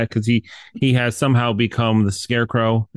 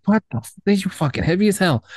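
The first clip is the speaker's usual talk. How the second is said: high-pitched, baffled mock exclamation; startled disbelief; 9 semitones higher than usual. Man hauling a kid up from the well, high startled disbelief. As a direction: act out someone thrown by an unexpected weight. Acts out man straining at rope.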